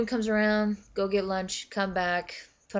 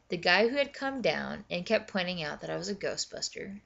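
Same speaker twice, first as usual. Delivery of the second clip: usual speaking voice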